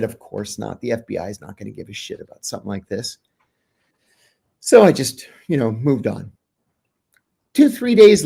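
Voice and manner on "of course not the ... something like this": muttered aside. Retold or imitated. Telling off a whistleblower friend.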